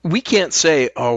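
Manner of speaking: ordinary delivery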